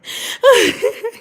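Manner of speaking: sudden squealing laugh; high burst of laughter. Real, not acted. Laughs at imagined viewer reaction.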